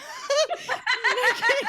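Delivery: loud, high burst of laughter. Real, not acted. Laughs at teasing.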